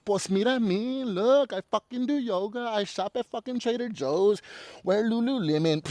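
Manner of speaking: mock yoga snob. Mocking fancy yoga people.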